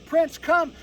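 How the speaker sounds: loud, firm call to the dog; firm dog recall. Pretend. Trainer calling his dog to come, loud and firm. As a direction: call him in like he's ranging off.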